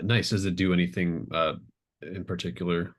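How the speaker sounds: ordinary delivery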